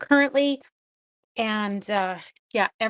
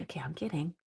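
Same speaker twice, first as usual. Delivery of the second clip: unvoiced whisper, letting the child off; whispered kidding; 5 semitones lower, 11 dB quieter, faster than usual. Teacher telling a disappointed pupil she was only kidding, whispered. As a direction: whisper it like a confession that it was a joke. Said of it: "I'm like".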